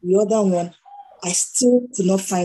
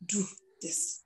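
quiet command; 13 dB quieter than usual. Acted. Quotes God's calm insistent answer.

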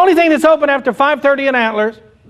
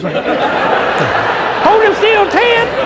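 high yelled order at a buddy; 10 semitones higher, much slower than usual. Acted. Jumper-cable dude yells to his buddy to hold a suspect, high shout. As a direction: shout it, slowly and excited.

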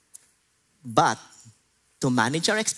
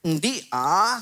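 put-on Ilonggo accent; 8 semitones higher than usual. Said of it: "Umiba yung accent, naging Ilonggo eh".

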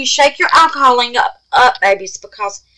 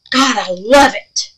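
normal talking voice

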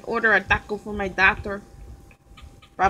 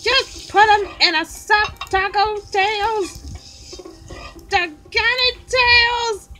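high-pitched, playfully exasperated character; high scolding voice; 12 semitones higher, slower than usual. Pretend. Character scolding Tails, in a high exasperated voice. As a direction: pitch it way up and nag.

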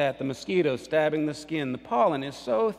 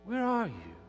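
plaintive call; 7 semitones higher, 3 dB quieter than usual. Acted. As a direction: call softly into emptiness. God calls for the lost brother.

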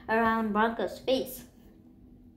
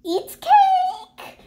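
excited squeal; 8 dB louder than usual. A narrator's reading. Dog exclaims about cake.

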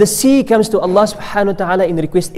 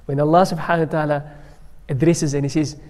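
ordinary talk, nothing marked

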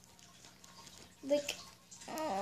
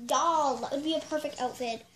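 ordinary talk

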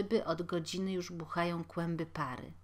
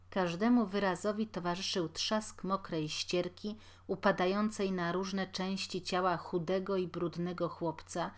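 usual speaking voice

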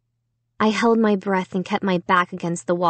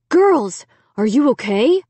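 put-on higher, louder call to the girls; worried dad's cry; 4 semitones higher, 4 dB louder than usual. A narrator's reading. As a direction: cry out to check on your daughters. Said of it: "Dad cried".

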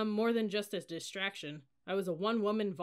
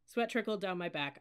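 ordinary delivery

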